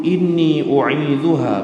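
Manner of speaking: slow melodic chanting of the verse; drawn-out Arabic recitation; Quranic recitation. Acted. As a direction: recite it slowly like prayer.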